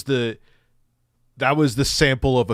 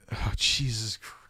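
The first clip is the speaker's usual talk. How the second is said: whisper; 2 semitones lower, 9 dB quieter than usual. Real, not acted. Exasperated aside under breath.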